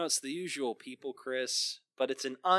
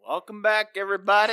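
hyped welcome; 7 semitones higher, 8 dB louder than usual. Acted. Mock host welcome.

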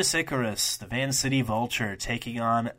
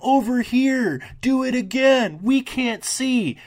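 high, loud whining mimicry of fans yelling from the seats; mocking whiny fans; 8 dB louder, slower than usual. Podcaster mocking wrestling fans who complain, high whiny heckle. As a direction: sneer it out as a squealing crowd heckler, dragging the words. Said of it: "I hate people who are like".

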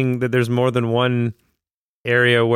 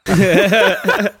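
high, loud laughing groan; 7 semitones higher, 4 dB louder than usual. Real, not acted. Co-host cracking up at the porn talk, laughing. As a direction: say it through a big laugh.

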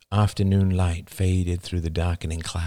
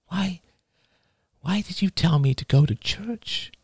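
put-on young woman voice; 11 semitones higher than usual. A narrator's reading. Rachel voiced higher.

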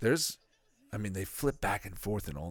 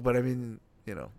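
ordinary delivery

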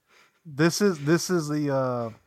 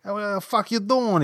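gruff Yankee; 9 semitones higher than usual. Retold or imitated. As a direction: ham up the crusty old Yankee. Impersonating gruff Yankee Dick.

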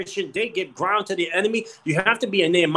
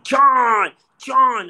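higher, louder call of a name; 7 semitones higher, 4 dB louder than usual. Retold or imitated. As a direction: call his name across the room. Muscle guy calls out to him.

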